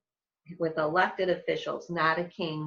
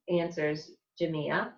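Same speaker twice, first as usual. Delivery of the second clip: ordinary delivery, nothing marked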